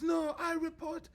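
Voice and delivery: put-on high, slow Chinese-accented threat; huffy woman with a Chinese accent; indignant Chinese-accented complaint. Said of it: "she goes".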